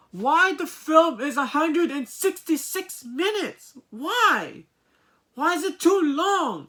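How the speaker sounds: loud, high-pitched indignant questioning; exasperated complaint. Real, not acted. Reviewer complaining about the overlong runtime, exasperated. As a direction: protest the length, high and loud.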